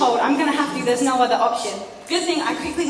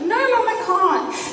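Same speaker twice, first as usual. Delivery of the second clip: whiny plea; 7 semitones higher, slower than usual. Retold or imitated. Whining to mum on phone.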